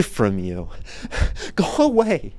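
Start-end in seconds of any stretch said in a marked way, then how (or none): 1.5-2.3 go away. Acted. Shooing away phone call.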